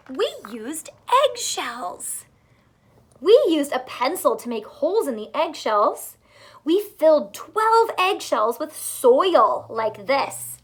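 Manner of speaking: slow, bright storybook reading; reading to children. A narrator's reading. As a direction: read it out warmly to little learners.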